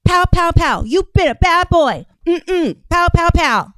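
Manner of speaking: loud, high, playful spanking play-act; hammed-up telling-off with loud sound effects; mock scolding. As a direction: egg her on, then punch out each smack. Acts out giving a mock spanking.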